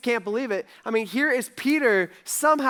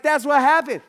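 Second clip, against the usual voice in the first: excited; 7 semitones higher, 7 dB louder than usual. Retold or imitated. Peter excitedly finishing his story.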